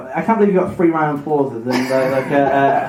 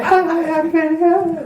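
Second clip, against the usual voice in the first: very high mock goddess voice; Freya; 11 semitones higher, much slower than usual. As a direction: imitate a lady in a silly high squeak. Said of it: "do the voice that you did".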